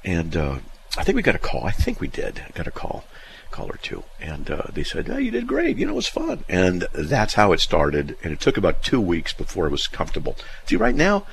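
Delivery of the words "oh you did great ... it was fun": cheerful praise. Retold or imitated. Station praising first show.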